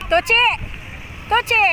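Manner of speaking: high, rising name calls; calling a dog. Real, not acted. Vlogger calls the dog Tochi, high-pitched calls. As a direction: call the pet sharply upward in pitch.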